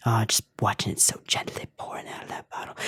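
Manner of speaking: soft, breathy whisper over the pouring milk; dreamy whisper. Pretend. YouTuber whispering to viewers about pouring milk, a mock-reverent whisper. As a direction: hush it like a sacred moment.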